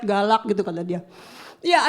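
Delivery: ordinary talk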